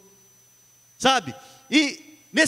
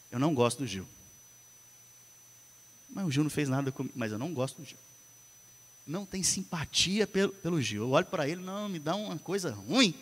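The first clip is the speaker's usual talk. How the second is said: soft murmured grudge, much softer; quiet inner thought; 6 semitones lower, 10 dB quieter, faster than usual. Retold or imitated. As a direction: mutter it like a secret resentment. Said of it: "o diabo lança um pensamento".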